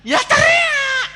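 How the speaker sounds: very high, long-drawn shout; full-voiced, soaring scream; exasperated yell. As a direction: scream it out, stretching the word. Exasperated demonstration of yelling.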